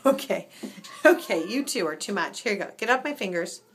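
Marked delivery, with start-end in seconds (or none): none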